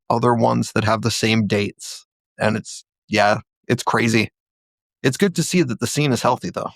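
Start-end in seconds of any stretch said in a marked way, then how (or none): none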